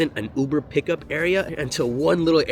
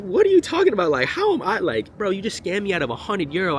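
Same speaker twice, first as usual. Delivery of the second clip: retold argument; 8 semitones higher than usual. Retold arguing with the scammer.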